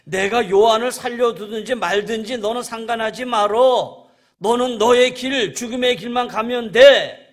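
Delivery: very loud, high-pitched order; thundering, final command; cutting rebuke. Acted. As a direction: shut him down at full force. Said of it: "묻자르지 탁 잘라요".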